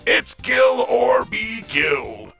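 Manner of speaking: ordinary delivery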